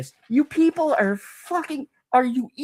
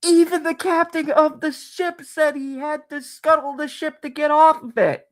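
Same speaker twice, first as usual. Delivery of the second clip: ordinary delivery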